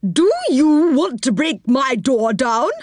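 high, indignant cry; cross old woman. A narrator's reading. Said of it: "she cried".

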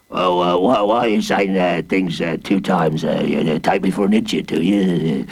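low, lumbering villain taking offence; gruff villain voice. A narrator's reading. Warden growling at the boy in low voice. Hooded Fang character voice.

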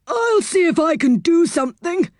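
put-on high, kindly old saucepan man; Saucepan's voice. Saucepan's kind offer.

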